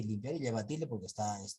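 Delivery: ordinary talk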